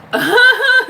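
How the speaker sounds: loud high cackle; real laugh. Real, not acted. Host amused by own dentist bit, cackling.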